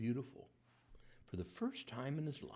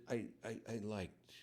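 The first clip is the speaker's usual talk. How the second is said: halting, stammered and softer; hesitant reply; 5 dB quieter than usual. Shown as a demonstration. Preacher acting out a lukewarm friend, hesitant. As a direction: hold back, unsure how much to admit. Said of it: "And you said".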